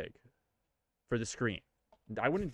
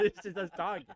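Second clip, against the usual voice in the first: higher, laughing repeat; 8 semitones higher than usual. Real, not acted. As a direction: say it again through a laugh.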